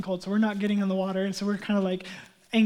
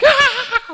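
high startled mock scream; imitated kid's shriek; 11 dB louder than usual. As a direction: shriek like a spooked kid. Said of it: "like, freaking out".

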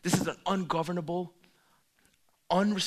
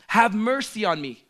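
pleading cry; 6 semitones higher, 11 dB louder than usual. Retold or imitated. Quoting the blind man's cry.

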